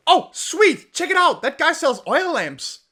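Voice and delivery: high, excited mock-naive voice; excited discovery. Player spots an oil lamp seller, excited discovery. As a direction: pipe up with giddy delight.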